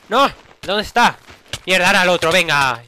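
loud high panic; panicked shout. Real, not acted. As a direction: yell it in panic. Panic in fight.

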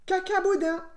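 high, loud rabbit blurt; cheeky retort. A narrator's reading. Little rabbit answers the wolf who would eat him, cheeky retort.

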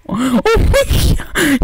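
high, slow shriek of shocked laughter; laugh-scream at the shock. Real, not acted. Shocked gamer shrieking with laughter at a death.

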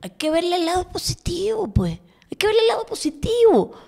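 high-pitched mocking echo of a pundit; mocking parrot. Host repeats the pundit's line about the bright side, mocking imitation.

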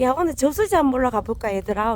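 loud, fast cheerful call; rally the dogs to go up the hill. Real, not acted. Inviting the dogs to the reservoir.

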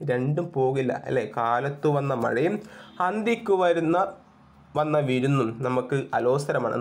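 usual speaking voice

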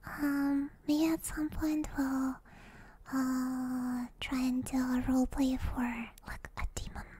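soft breathy whisper close to the mic; ASMR whisper. Acted. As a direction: whisper as if lulling viewers to sleep. Whispered ASMR stream chat.

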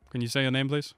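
ordinary delivery

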